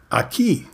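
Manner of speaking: "aquí" said carefully in Spanish, ah-KEE; Spanish word aquí. Shown as a demonstration. Teaching the Spanish word "aquí", said clearly ah-KEE.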